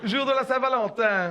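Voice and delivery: ordinary talk, nothing marked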